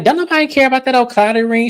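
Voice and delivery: high, fast imitation of a dismissal; mock scornful retort. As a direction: fire back a mocking put-down, pitch way up. Acting out Kiana's retort to Stacy.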